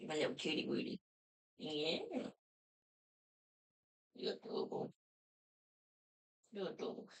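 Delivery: soft breathy cooing whisper; whispered baby talk. Acted. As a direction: whisper sweetly to the pet.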